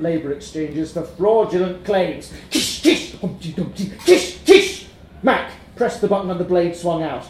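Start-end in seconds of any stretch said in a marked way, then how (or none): 2.5-4.9 hissed kish, chanted Humpty Dumpty; band sound imitation. A narrator's reading. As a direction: mimic the drums and bass.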